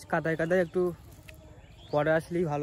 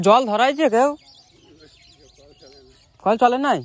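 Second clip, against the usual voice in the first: loud, high call across the field; 8 semitones higher, 13 dB louder than usual. Real, not acted. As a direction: shout to someone far off. (দূরে কাউকে ডেকে জিজ্ঞেস)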